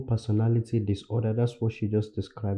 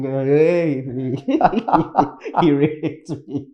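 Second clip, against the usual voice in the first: drawn-out, louder mock announcement broken by laughter; mock calling out; 2 semitones higher, 6 dB louder, much slower than usual. Imitating her announcing it from the Eiffel Tower, mock calling out. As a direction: pretend to proclaim it from a height, laughing.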